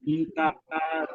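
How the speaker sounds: mtahara said slowly with the long double A drawn out; stretching the double A. Shown as a demonstration. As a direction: lean into the aa in mtahara. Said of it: "so it's a double A".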